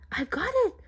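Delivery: high, sudden burst of hope; excited cry. A narrator's reading. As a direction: shout it as the plan comes. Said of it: "she cried".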